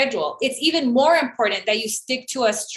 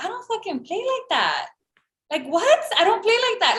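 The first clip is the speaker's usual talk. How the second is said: high-pitched, incredulous retort; mock-indignant, pitched up; indignant comeback; 9 semitones higher than usual. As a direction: act out refusing to put up with it. Acts out own indignant comeback.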